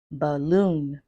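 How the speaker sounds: put-on isolated words, BAby, baLLOON, reMARK, DOCtor, EARly; word stress demo. Shown as a demonstration. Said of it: "you can see where I put the stress".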